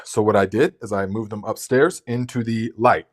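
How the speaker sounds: normal talking voice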